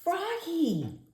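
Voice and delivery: high falling call of his name. A narrator's reading. Miss Otterbottom calls Froggy, a high falling call. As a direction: call him to order from across the room. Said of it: "called Miss Otterbottom".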